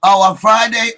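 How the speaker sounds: a happy jolt of surprise at the news; surprised. Real, not acted. Host reads the Friday plan in chat, loud surprised reaction.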